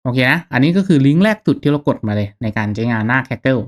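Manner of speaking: ordinary talk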